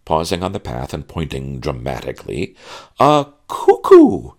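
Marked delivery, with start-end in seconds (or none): 3.0-4.3 melodramatic exclaim. Acted. Maid exclaims dramatically at bird.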